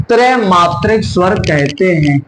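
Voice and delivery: ordinary delivery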